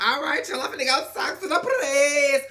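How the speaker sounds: high, loud mock excitement; acted-out giddy reply; excited announcement.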